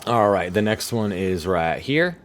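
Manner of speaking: usual speaking voice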